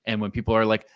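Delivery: ordinary talk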